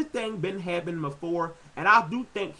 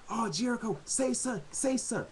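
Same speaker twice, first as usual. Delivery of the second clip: higher, softer pleading fan voice; imitating pleading fans; 7 semitones higher, 4 dB quieter than usual. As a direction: beg him to talk like an impatient fan, up high. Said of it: "Everybody like".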